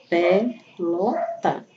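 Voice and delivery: Pelota said very slowly, syllable by syllable: pe-lo-ta; split into syllables. Shown as a demonstration. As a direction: stretch out Pelota, pausing between each syllable. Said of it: "a divisão dessa palavra se forma em sílabas". (Pelota bem devagar, separando as sílabas)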